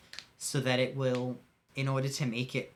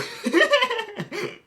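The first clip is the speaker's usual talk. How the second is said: shrill giggle burst; high squealing laugh; 9 dB louder than usual. Real, not acted. Laughs at own joke.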